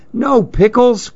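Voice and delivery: high, surprised cry of dismay; a bright little gasp of disbelief. A narrator's reading. Susan finding the pickle jar empty, surprised cry. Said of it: "cried Susan".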